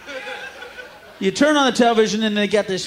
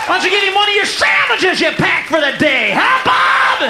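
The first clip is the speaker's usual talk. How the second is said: yelling guilt trip; 10 semitones higher than usual. Retold or imitated. Commercial voice yelling at viewer Bob, high accusing shout. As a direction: blast the viewer for not sharing. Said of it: "It's like".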